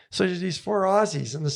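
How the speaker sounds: normal talking voice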